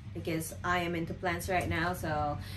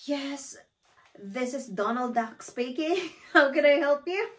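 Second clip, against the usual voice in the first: imitated prank agent; 8 semitones higher, 5 dB louder than usual. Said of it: "You know what she said?".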